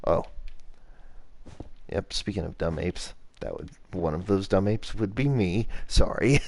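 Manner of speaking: normal talking voice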